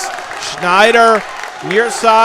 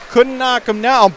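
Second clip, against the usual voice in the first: ordinary talk, nothing marked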